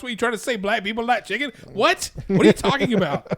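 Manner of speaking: high-pitched incredulous indignation; mock outrage. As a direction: act out being offended, climbing to a yelped question. Acts out someone taking offence.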